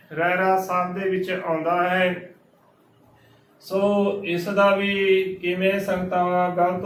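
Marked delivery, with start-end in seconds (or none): none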